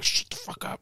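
urgent whispered hush. Retold or imitated. Dad hushing the daughter at the door, whispered. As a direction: hiss it under your breath.

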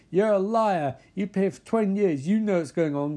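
high, heated accusation; accusing. Acted. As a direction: throw it as an acted accusation. Acted accusation in quarrel.

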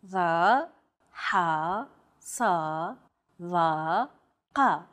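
Va, ha, sa, va, ka made deep within the throat; tense, constricted throat on Va, ha, sa, va, ka, one at a time; emphatic consonants. Shown as a demonstration. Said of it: "pronounced deep within the throat".

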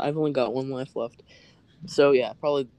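normal talking voice

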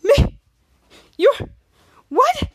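loud, high, stunned yelp; shocked outburst. Retold or imitated. Henry hearing Bianca's date is Mitch, loud high shock. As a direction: blurt it out in disbelief. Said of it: "And Henry's like".

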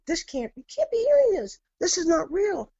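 very high, frightened disbelief; squeaky, panicked retold gasp of words; retold freak-out. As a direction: relive the shock of the voices.

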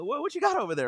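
high, quick stammering question; comic flustered dad. Retold or imitated. Dad dodging the price, high hurried fluster. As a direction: hurry it out high, looking for a way out. Dad hunting for cheaper shoes.

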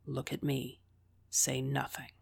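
soft urgent whisper meant for one ear. A narrator's reading. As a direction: whisper it so only she hears. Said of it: "William whispered so that only I could hear".

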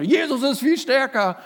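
high, loud, firm cry; retold shout. Wife shouting down the growling man, high and loud. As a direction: shout it back at him with full force. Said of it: "schrie sie ihn an".

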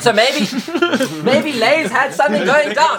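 high giggly theorizing; laughing, voice up high. Real, not acted. Laughing conspiracy theory about chips.

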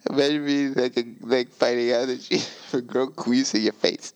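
ordinary talk, nothing marked

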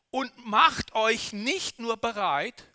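ordinary talk

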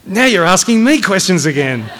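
high, loud, exasperated reproach; angry accusation. Acted. Colonel accusing cadet of asking questions, angrily. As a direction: catch the cadet out indignantly.

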